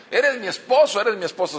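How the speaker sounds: hammed-up wife's protest; exasperated spouse. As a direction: shake him with the words. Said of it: "lo quiere sacudir".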